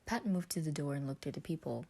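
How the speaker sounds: usual speaking voice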